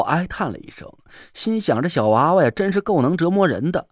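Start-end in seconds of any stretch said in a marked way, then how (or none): none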